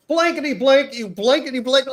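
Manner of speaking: high-pitched mock cursing tirade; goes off ranting. Masked hiker cursing out two brothers, going off in retold rage. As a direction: fire off censored swearing in a high, worked-up voice. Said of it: "He completely goes off".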